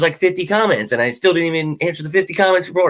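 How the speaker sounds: usual speaking voice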